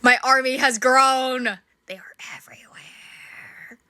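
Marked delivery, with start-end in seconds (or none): 1.9-3.8 hushed, ominous whisper; spooky whisper. Acted. Watching tribbles multiply, she whispers it ominously. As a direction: breathe it like a horror-movie secret.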